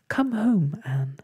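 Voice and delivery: high, urgent little plea; frightened whisper. A narrator's reading. Ragged Blossom begs Anne to leave, frightened. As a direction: plead anxiously to be taken home. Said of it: "whispered Ragged Blossom".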